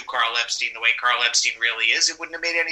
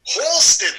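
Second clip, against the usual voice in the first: high, loud Brooklyn call; exaggerated old-school Brooklyn accent; Borough Park accent; 12 semitones higher, 6 dB louder than usual. Shown as a demonstration. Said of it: "I looked at his accent, which is Borough Park".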